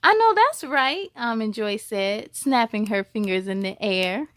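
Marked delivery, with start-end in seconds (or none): 0.0-1.1 sassy cheer. Acted. Classmate cheering.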